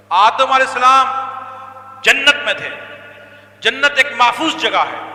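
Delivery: loud, slow, high sermon cadence; impassioned preaching. Acted. Preacher telling listeners of Adam in Paradise, loud impassioned sermon. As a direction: proclaim each phrase like a verdict.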